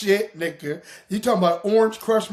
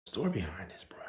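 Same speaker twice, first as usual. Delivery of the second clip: unvoiced stage whisper; hushed teaser whisper; 11 semitones lower, 11 dB quieter, faster than usual. Shown as a demonstration. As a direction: whisper it like a secret. Repeated, then whispered.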